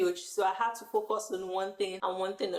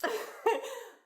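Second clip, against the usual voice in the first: high breathy giggle; 11 semitones higher than usual. Real, not acted. Laughs about new friend.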